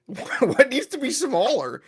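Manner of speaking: high, drawn-out disbelieving question; incredulous. Real, not acted. Defending the thumbnail text to a co-host, incredulous. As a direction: challenge it, high and slow. Disputing co-host's thumbnail criticism.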